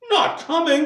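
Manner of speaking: high, loud incredulous echo; dismayed disbelief. A narrator's reading. Bob's high spirits suddenly drop, dismayed disbelief. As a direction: repeat it as a shocked question. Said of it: "with a sudden declension in his high spirits".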